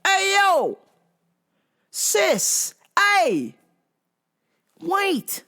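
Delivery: high, loud warning call. Retold or imitated. Vlogger warning the driver backing into his car, high loud call. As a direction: yell up to the driver backing toward you. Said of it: "I was like".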